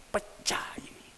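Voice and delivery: whispered and hushed; dramatic whisper. Acted. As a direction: let it land in a whisper. Dramatic retelling of injury.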